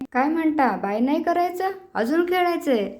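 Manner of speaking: a playful, high-pitched surprised tease; mock surprise. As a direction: act amazed that they still want to play. Mock surprise at kids wanting more.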